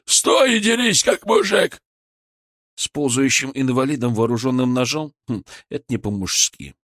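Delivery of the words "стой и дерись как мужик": high, strained shouted challenge; angry yell. A narrator's reading. Бычок dares the narrator to fight, angry yell. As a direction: bellow the dare, pitched well up. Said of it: "орал Бычок".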